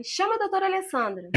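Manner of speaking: urgent call. Retold or imitated.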